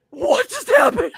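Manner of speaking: high, loud, slow bewildered cry; the pastor baffled; startled pastor. Retold or imitated. Pastor a foot away, startled, high and loud.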